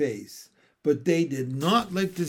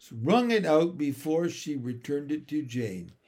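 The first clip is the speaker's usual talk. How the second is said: plain reading voice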